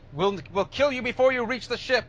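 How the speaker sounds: high, urgent shout of warning; yelled warning. Acted. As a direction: yell a frantic warning across a distance. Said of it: "he, he yells out".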